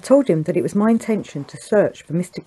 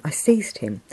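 usual speaking voice

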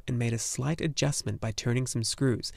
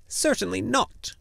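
hissed; 10 semitones higher than usual. A narrator's reading. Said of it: "the little woman hissed".

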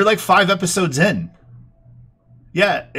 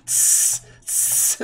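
snake hiss sound; 4 dB quieter than usual. Retold or imitated. Imitating snakes.

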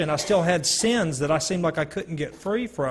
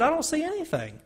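raised, a lightly voiced young woman; a hint of the girl, pitched up; the girl's reply; 12 semitones higher, 4 dB quieter than usual. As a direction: answer him lightly and higher, as the girl. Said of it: "She said".

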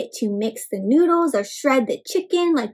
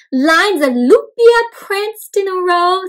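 storybook reading; 6 semitones higher, 6 dB louder, slower than usual. A narrator's reading. Storybook read to children.

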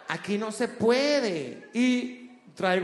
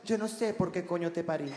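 tender mother; 4 semitones lower, 8 dB quieter than usual. Retold or imitated. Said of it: "de una manera tierna".

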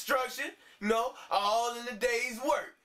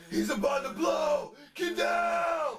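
panicked shout; 7 semitones higher, slower than usual. Acted. Friend warns the room before the blast, panicked shout. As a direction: yell at everyone to duck.

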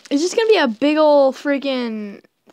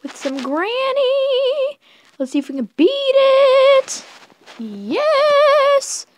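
much higher, excited; 11 semitones higher, slower than usual. Pretend. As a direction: squeak it out eagerly. Excited high gaming voice.

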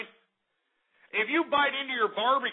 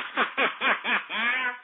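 real laugh; 2 semitones higher than usual. Real, not acted. Boasting about a Texan president, then a real laugh. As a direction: laugh at your own joke.

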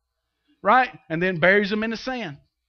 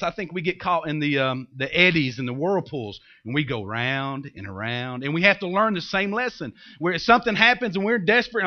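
ordinary talk, nothing marked

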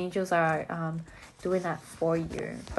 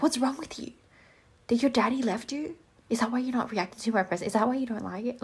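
higher, mock indignant scolding; mock outrage; 5 semitones higher, faster than usual. Gift giver mock scolding a friend for not reacting, hammed-up indignation. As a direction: play up being offended over the gift. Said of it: "I'm like".

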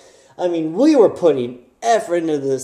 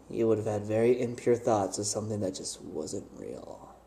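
much softer, lower, sighing; hushed confession; 11 semitones lower, 14 dB quieter than usual. Real, not acted. Admitting what dad might think, soft low sighing hush. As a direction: trail off in a hush. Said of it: "dropping to confessional hush".

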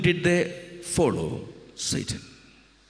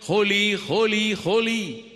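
higher, loud proclamation; angels crying holy; 10 semitones higher, 3 dB louder than usual. Acted. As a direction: call it out in awe. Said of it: "angels flying around crying".

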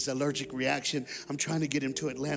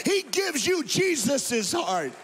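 soaring higher and louder, slowing into a shouted climax; preaching at full force; 8 semitones higher, 3 dB louder than usual. Acted. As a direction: lift it to a roaring preacher's peak.

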